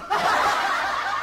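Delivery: laugh. Real, not acted. Laughs at own story.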